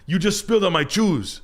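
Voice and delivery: a hint of a high angry reply; buddy talking back. The buddy answers the girl in a high put-on voice. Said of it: "And I was like".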